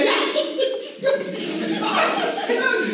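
high imitated giggle; a sister's high giggle at the screen; giggly laugh. Sister giggling at her video call, high laugh.

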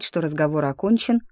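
ordinary delivery, nothing marked

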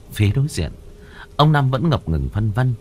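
usual speaking voice

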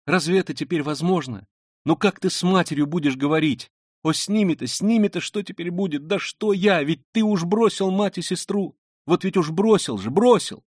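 high, agitated stream of questions; distraught Sonya. Acted. Sonya lamenting his mother and sister, distraught. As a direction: plead with mounting urgency.

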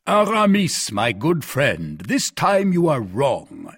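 slow, weighty warning to a friend; measured, emphatic rebuke; stern reproof. Acted. Porthos sternly reproves his friend.

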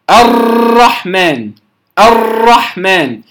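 الرحمن with the ra trilled long and hard, slowly; over-trilled ra. Shown as a demonstration. Teacher showing students the wrong over-trilled ra, slow. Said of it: "هذا خطأ".

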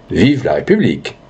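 restrained, obliging repeat of the slogan; understated agreement with a voter; candidate's echo. Acted. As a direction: parrot the cheer back without its force. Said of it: "Le candidat répondit".

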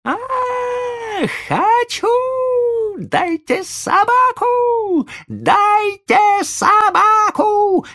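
very high, drawn-out tantrum shriek; shrieking boy. A narrator's reading. Boy kicking the footman and demanding the dog, shrieking. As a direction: squeal it slowly like a spoiled child. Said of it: "взвизгивал мальчик".

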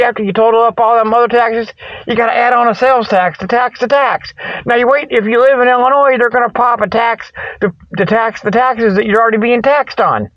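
ordinary delivery

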